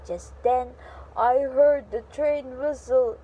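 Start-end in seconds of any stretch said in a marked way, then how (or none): none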